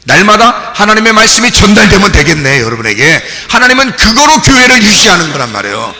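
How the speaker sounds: loud, high climactic sermon cry; impassioned pulpit shout to the congregation; full-force preaching. Real, not acted. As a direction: proclaim it at the top of the voice.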